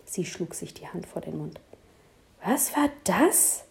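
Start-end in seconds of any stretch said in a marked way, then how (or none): 2.4-3.6 gasped. Acted. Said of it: "keuchte sie".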